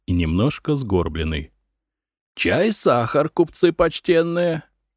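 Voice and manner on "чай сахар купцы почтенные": put-on high old man's greeting. A narrator's reading. Old man greeting honoured merchants at tea, high aged voice. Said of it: "Вошёл старик".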